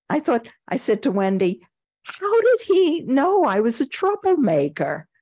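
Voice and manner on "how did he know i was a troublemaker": high-pitched retelling of naive amazement; mystified question. Retold or imitated. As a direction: re-enact baffled wonder, pitched way up. Retells asking Wendy in wonder.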